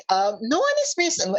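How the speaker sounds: high-pitched, hedging denial; hammed-up nervous cover-up. Centaur denying to worried townsfolk.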